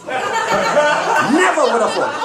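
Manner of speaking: slow punchline. Acted. Punchline drawn out slowly.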